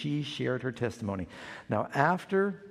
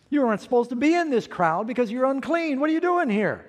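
scolding crowd; 8 semitones higher, 5 dB louder than usual. Retold or imitated. Imagined crowd scolding unclean woman.

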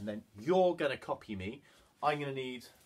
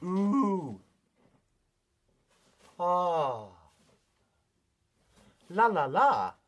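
vocalize as a playful horse puppet; voiced puppet horse; 2 semitones higher, 5 dB louder, much slower than usual. Leader voices Mister Horse for children to echo, playful. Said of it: "Mister Horse says".

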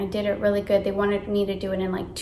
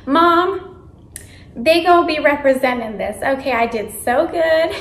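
louder, higher, bursting with pride; thrilled kid telling mom she nailed the interview; excited to mom; 4 semitones higher, 6 dB louder than usual. Retold or imitated. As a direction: brag to mom about nailing it.

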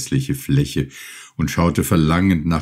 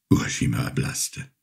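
ordinary delivery, nothing marked

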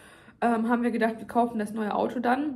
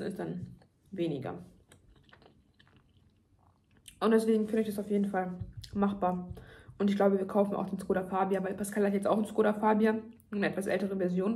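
talking between bites; speaking with food in mouth; tags: chewing while talking. Real, not acted. Seen 4: eats between phrases, brings food to mouth during words.

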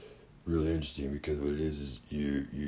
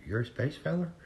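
incredulous; 11 semitones higher than usual. Retold or imitated. Stoner astonished at alien claim.

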